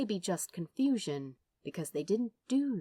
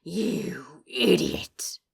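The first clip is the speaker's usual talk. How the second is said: slow, louder growl of contempt; Crowley growls at the angel, slow and menacing; 5 dB louder, much slower than usual. Acted. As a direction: drag the insult out in a menacing growl. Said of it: "Crowley growled".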